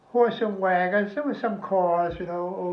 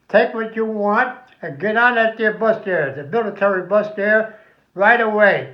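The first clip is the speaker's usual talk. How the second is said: louder, hurrying them along; loud order; 3 semitones higher, 7 dB louder than usual. Retold or imitated. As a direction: push the men onto the bus. Official hurrying soldiers onto bus.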